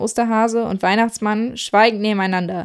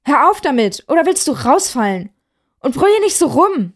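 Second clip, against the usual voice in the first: louder, higher telling-off; scolding; 6 semitones higher, 4 dB louder than usual. Acted. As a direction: snap at him to stop. Santa scolds the rabbit.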